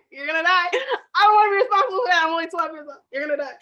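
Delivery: fast, high, laugh-crying panic; panicked kid laughing through tears. Retold or imitated. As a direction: squeal it out half laughing, half crying. Retelling scared twelve-year-old self.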